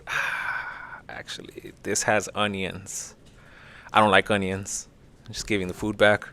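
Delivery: barely voiced, sheepish; timid captive handing the food back; mock timid prisoner. A prisoner politely refusing food.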